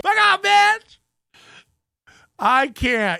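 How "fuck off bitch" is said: high, loud mock yell; imitated shout. Commenter copying the man yelling at the woman, mock shout.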